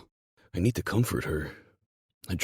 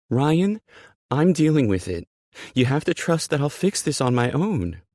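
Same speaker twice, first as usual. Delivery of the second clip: high, loud, desperate insistence; agitated high-pitched protest; pleading insistence; 11 semitones higher, 8 dB louder than usual. Acted. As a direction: beg him to back off. Character insists in a heated quarrel.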